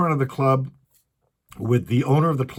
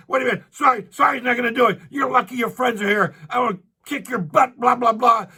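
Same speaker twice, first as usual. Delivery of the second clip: retold screaming; 10 semitones higher, faster than usual. Angry stranger threatens the comic outside the club, mock yell. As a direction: shout it like he's about to swing. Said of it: "He's, like, screaming at him".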